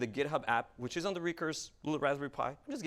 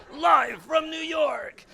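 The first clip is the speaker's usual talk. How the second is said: big, slow show-opening holler, much higher and louder; TV announcer shout; 14 semitones higher, 12 dB louder, much slower than usual. Acted. Presenter realising the demo is live, bellowing like an announcer. Blurts the TV catchphrase.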